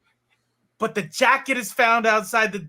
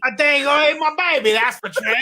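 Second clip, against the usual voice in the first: higher-pitched put-on Australian; mock Australian accent; 6 semitones higher, 3 dB louder than usual. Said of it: "Robo's impersonation of me".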